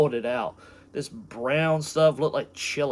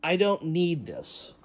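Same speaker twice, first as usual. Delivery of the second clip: normal talking voice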